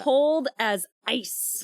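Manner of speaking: drawn-out, icy; slow cold. Retold or imitated. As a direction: stretch each word out slowly. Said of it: "cold-blooded".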